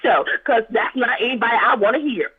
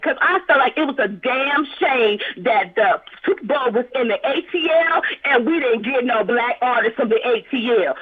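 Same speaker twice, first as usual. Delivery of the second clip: ordinary talk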